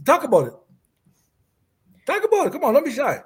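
high-pitched teasing encouragement; playful coaxing. Real, not acted. Teasingly urging a shy guest.

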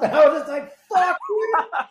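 loud, high-pitched laughing outburst; amazed laughter. Real, not acted. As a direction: burst out laughing through the words, voice shooting up. Laughing while retelling his stunned reaction.

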